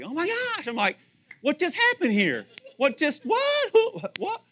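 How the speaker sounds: high, rising cries of what; mock bewildered exclamation. Husband baffled by wife's rhetorical question, mock bewilderment. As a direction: throw up your hands, baffled.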